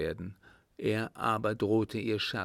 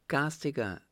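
called out; 6 semitones higher than usual. Acted. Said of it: "rief endlich Hedwig".

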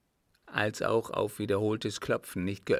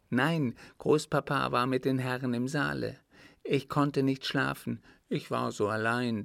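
put-on earnest child voice, pitched a bit higher; little boy recounting; 3 semitones higher than usual. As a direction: tell it as a small boy confiding what he saw. Narrator voices little Richard telling story.

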